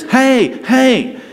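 a mild mock call for attention; retold calling out. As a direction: call out hey, hey after someone. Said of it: "I heard this".

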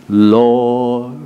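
a drawn-out pious "Lord" with a rising tune; mock the stock churchy prayer, slow and solemn; church prayer voice. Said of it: "they pray that church prayer".

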